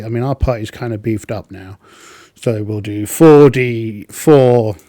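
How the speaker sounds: normal talking voice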